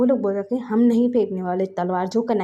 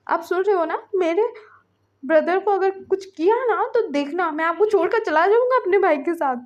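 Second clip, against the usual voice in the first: high, insistent threat; insistent warning; 9 semitones higher than usual. A narrator's reading. Ving threatens to leave his husband, high-pitched warning. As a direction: warn in a sustained raised pitch.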